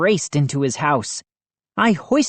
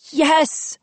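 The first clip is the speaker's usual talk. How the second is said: high, bright mild cheer; 12 semitones higher than usual. A narrator's reading. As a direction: cheer with a fist up. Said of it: "I cheered".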